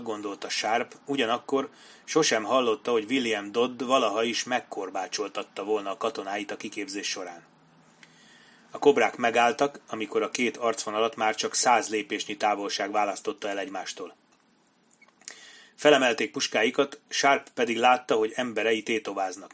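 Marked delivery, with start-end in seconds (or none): none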